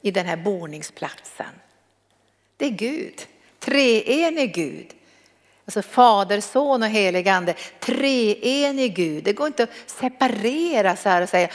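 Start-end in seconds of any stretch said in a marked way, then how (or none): 3.6-4.9 slow emphatic. Acted. Solemn doctrinal emphasis. 7.8-9.2 slow emphatic. Acted. Solemn doctrinal emphasis.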